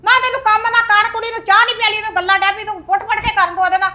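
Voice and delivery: angry scolding. Acted. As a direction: tell her off loudly. Mother scolding her daughter.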